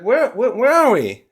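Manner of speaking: bewildered high voice. Acted. Kids waking up lost.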